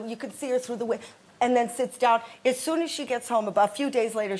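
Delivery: normal talking voice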